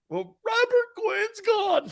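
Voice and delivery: slow, very high wail; mock crying. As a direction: drag it out in a squeaky fake sob. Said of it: "why are you crying?".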